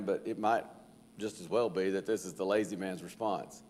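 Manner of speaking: ordinary talk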